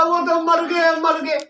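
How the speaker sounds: high, put-on lament; hammed-up fake crying announcement; mock wailing. Wife pretending to weep over husband's death, mock wailing. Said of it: "रोने का नाटक किया".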